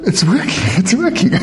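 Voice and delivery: quick, laughing disbelief; imagining stepping onto water, laughing with delight. Retold or imitated. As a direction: giggle as it somehow works. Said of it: "I'm going".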